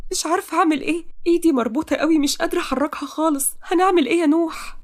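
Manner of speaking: high-pitched, helpless tearful plea. Acted. Heroine cannot free her tied hands, tearful. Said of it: "تقى بدموع".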